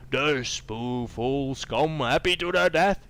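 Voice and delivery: slow, drawn-out ogre taunt rising loud; gloating ogre. Acted. Ogre taunting the heroes, slow gloating brute voice. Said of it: "he grins".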